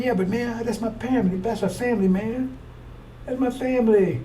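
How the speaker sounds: half-hearted mock plea, repeating "that's my family"; sheepish excuse. Retold excuse about family pork farm.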